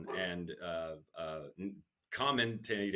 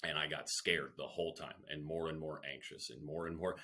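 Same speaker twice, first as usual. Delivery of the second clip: ordinary talk, nothing marked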